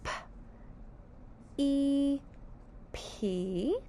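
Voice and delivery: P then E then P, spaced apart; sounding out syllable. Shown as a demonstration. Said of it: "Sound people".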